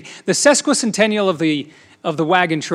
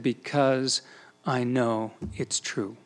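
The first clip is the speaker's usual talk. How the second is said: lower, softer and slow, simply sure; quiet conviction; 5 semitones lower, 8 dB quieter, much slower than usual. Retold or imitated. Young Les answering the reporter, lower, softer and slow with quiet certainty. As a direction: let it land gently and firmly. He looked over at me.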